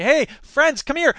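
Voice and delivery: high, loud, punchy hailing of passers-by; calling out. Retold or imitated. Activist at a card table rounding up friends, calling out. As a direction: hail friends over eagerly, pitched well up.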